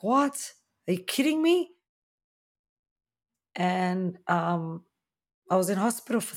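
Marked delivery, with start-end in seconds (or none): 0.0-1.7 high-pitched incredulous questions; shocked disbelief. Retold or imitated. Patient to staff on being told she's dilated, in shocked disbelief. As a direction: retell your own stunned reaction, voice jumping up.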